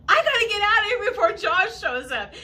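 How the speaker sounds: high, loud, fast mock panic; fleeing the water before a shark comes; panicked rush. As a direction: blurt it out in a hammed-up panic. Bleeding in water, fear of shark.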